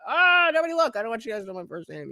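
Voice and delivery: usual speaking voice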